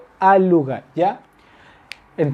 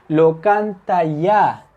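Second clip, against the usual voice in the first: slow model word; much slower than usual. Shown as a demonstration. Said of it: "teacher models dative suffix".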